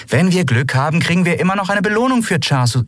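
high, hurried argument; quarrelling. A narrator's reading. Captain arguing about the reward, high and insistent. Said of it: "sich anschrien".

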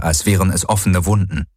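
ordinary delivery

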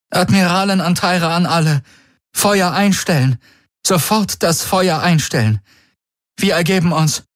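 high, broken-voiced surrender; high and distraught. A narrator's reading. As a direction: give the order as a defeated commander. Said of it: "sagte sie mit gebrochener Stimme".

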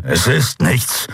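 slow, dragged-out growl. A narrator's reading. Wounded creature lying about his injury, growling slowly. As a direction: growl through the pain, slowly. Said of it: "knurrte er".

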